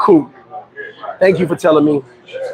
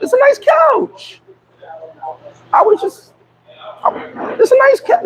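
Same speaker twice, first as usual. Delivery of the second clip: high squeaky falsetto, slowed on couch; mock innocent falsetto; slower than usual. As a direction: tease him in a high sing-song. Teasing falsetto about couch.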